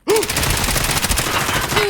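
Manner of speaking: loud high shocked gasp; sharp gasp. Real, not acted. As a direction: gasp in sudden horror. Shocked after shooting teammate.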